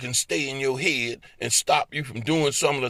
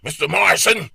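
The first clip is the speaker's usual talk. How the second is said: louder, brisk scolding doctor; doctor's voice; 4 semitones higher, 5 dB louder than usual. Retold or imitated. Doctor scolding him inside his head, brisk. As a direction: rattle off the warning. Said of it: "I can hear my doctor's voice".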